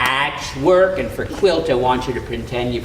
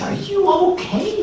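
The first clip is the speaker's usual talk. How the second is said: high worried voice. A narrator's reading. Neighbors worried.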